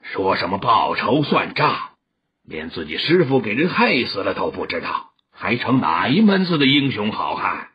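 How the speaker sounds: put-on low, scornful old master; loud-clear declaiming. A narrator's reading. Old master taunts the sworn avengers, declaiming low and clear. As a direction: declaim it low, with mocking weight. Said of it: "朗然说道".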